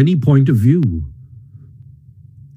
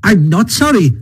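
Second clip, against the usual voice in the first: high-pitched unrepentant character voice; stoutly unrepentant, pitched right up; defiant Toad; 10 semitones higher than usual. A narrator's reading. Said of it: "a little sullenly but stoutly".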